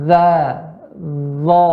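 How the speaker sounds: ZA and ZO said wrongly, tongue tip behind the upper teeth; tongue tip too far in. Shown as a demonstration. Teacher showing what not to do, ZA and ZO with tongue inside. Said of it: "di dalam".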